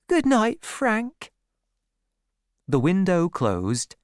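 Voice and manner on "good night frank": put-on high young woman's goodnight; high-voiced sweetheart taking leave; girl's farewell. A narrator's reading. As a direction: say goodnight meekly, pitched way up.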